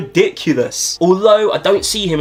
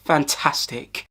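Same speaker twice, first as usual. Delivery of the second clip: dry sarcasm; 3 semitones lower, 7 dB quieter, much slower than usual. Acted. Sarcastic reaction to Milner.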